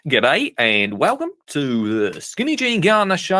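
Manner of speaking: a lightly put-on Australian accent, slow; mock Australian accent. Host greets Australian listeners in a mock Australian accent. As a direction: say it like a bloke from Down Under. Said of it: "do the whole thing in an Australian accent".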